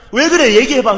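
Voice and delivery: loud, high irritated demand; impatient shout at a late-night friend; impatient yell. Retold or imitated. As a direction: snap at a friend who won't talk. Acted impatient retort.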